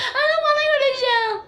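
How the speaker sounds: high, whiny wail; hammed-up tearful pleading; mock crying. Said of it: "I start crying again".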